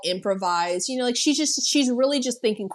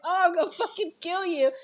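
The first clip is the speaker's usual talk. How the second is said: pissed-off threat; 7 semitones higher, 4 dB quieter, faster than usual. Retold or imitated. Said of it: "He's pissed".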